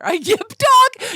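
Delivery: high, loud, slow laughing disbelief; indignant surprise. Real, not acted. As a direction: laugh it out in outrage.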